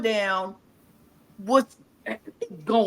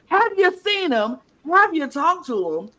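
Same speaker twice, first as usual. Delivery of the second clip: mock worry; 7 semitones higher than usual. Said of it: "They like".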